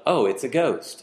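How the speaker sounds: understated, unbothered remark; mock calm remark. Shown as a demonstration. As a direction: say it casually, as if a ghost were nothing. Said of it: "How does that sound?".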